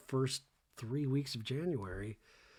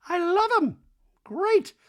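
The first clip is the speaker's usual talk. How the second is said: very high, loud, squeaky sing-song voice; hammed-up high Dorit voice; mock Dorit; 10 dB louder than usual. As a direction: gush it like a ditzy diva. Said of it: "Dorit's like".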